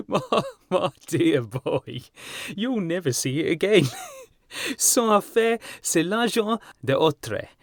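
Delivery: high, slow speech shaking with laughter; laughing through words. A narrator's reading. Trevor laughing at Hughie's lost sovereign. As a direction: talk while still laughing. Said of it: "burst into a roar of laughter".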